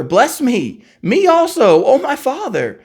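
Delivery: high, loud anguished plea, voice climbing; bitter pleading cry. A narrator's reading. Esau cries out to his father in bitter pleading. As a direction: beg like a son who lost everything. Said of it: "he cried with an exceedingly bitter cry".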